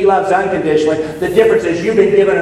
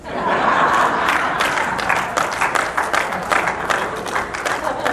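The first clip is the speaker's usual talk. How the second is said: unvoiced breathy laugh at own joke; a quiet, airy laugh at his own joke; 8 semitones higher, 4 dB quieter than usual. Real, not acted. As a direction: let out a soft airy laugh.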